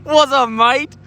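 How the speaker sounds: jokey put-on British accent, rising at the end; mock British accent. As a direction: try on a cheeky British "mate". Playing at accents for the chat.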